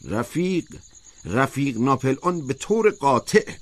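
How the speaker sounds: deliberate, insistent official pronouncement; measured and firm. A narrator's reading. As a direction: press each phrase home with authority.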